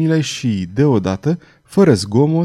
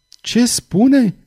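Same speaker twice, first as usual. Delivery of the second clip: high-pitched, stirred question; moved, agitated question; 11 semitones higher than usual. A narrator's reading. Charles overhearing at the doorway, agitated question. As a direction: ask it shaken, voice lifted. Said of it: "întrebă emoționat Charles".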